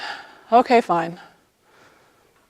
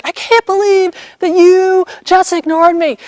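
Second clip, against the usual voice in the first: mock outrage at being ignored; imitating an angry girl; 9 semitones higher than usual. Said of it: "just really mad".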